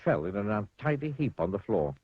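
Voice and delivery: ordinary delivery, nothing marked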